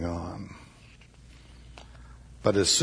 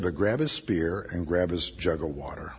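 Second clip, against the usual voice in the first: whisper; 6 semitones lower, 5 dB quieter than usual. Acted. David's secret order at night.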